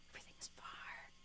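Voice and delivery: whispered, barely there. Retold or imitated. Ted in his apartment, a defeated whisper. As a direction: let the cheer collapse into a whisper. Ted's denial, whispered after.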